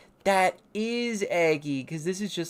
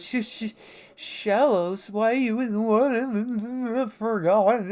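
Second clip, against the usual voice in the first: high, slow stammering shy voice; shy stammering creature; 8 semitones higher, 6 dB louder, slower than usual. Retold or imitated. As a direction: stammer out a name, then ask nervously. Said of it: "And then he goes".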